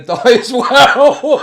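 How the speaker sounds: real dark laugh creeping in. Real, not acted. Streamer laughing at a bleak thought.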